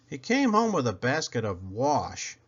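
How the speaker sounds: plain reading voice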